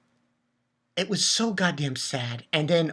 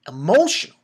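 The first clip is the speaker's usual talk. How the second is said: emphatic; 2 semitones higher, 7 dB louder than usual. Real, not acted. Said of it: "Really emotional".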